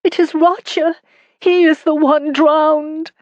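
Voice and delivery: a distraught wife sobbing out bad news; tearful young wife. A narrator's reading. Wife crying to her husband, high and tearful.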